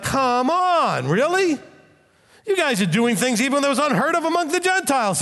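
high, incredulous scolding; a preacher voicing Paul rebuking the church; Paul exasperated. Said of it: "That's what Paul sounded like in my head".

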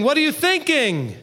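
high rebuking question; loud challenge. Retold or imitated. Scripture getting loud to get our attention. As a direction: shake them awake with the question. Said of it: "get loud with us".

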